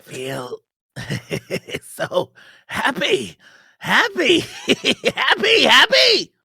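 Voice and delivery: high, slow laughing monster voice; laughing happy. Acted. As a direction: giggle out happy, building to a shout. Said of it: "repeats the word happy, laughing".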